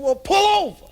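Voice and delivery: high, loud eager orders; excited Chris. Acted. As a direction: push the crew, all worked up.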